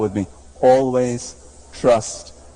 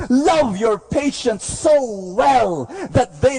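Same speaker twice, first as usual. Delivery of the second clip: high, ringing preacher's call; full-force preaching; 10 semitones higher than usual. Acted. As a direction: lift the whole church with every line.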